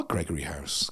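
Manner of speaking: hushed unvoiced aside; whispered. Real, not acted. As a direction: drop to a whisper.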